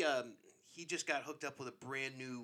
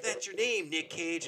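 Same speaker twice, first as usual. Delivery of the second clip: higher, louder taunting; teasing friends; 5 semitones higher, 5 dB louder, slower than usual. Retold or imitated. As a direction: rub it in like mocking buddies. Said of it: "And they're like".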